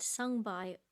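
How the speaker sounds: normal talking voice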